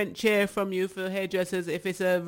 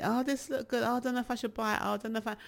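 higher, dithering mock shopper voice; mock picky buyer; 6 semitones higher, 3 dB quieter, much faster than usual. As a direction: play a fussy buyer who can't decide. Mocking picky indecisive buyers.

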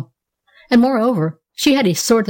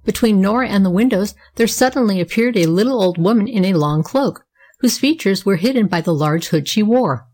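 plain reading voice

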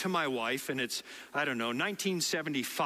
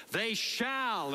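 shall stretched out on a raised pitch; stress on shall; 6 semitones higher than usual. Retold or imitated. Preacher reads the verse to an enthusiastic group, shall heavily emphasized. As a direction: lean hard and long on the word shall. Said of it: "he really emphasized the word shall".

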